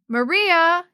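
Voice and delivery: loud, high exclamation of a name; startling call. Acted. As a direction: call out sharply to catch a child. Startling call from Missus Vargas.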